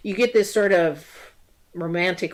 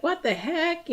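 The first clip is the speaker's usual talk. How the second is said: high, indignant what the heck; surprised; 12 semitones higher, 3 dB quieter than usual. Retold or imitated. Girlfriend finding out the proposal was a joke, shocked. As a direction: voice shoots up in disbelief. Girl learns ring was fake.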